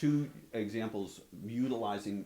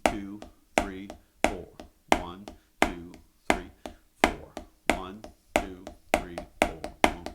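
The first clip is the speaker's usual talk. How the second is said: counting aloud; 8 dB louder, slower than usual. Shown as a demonstration. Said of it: "do it again".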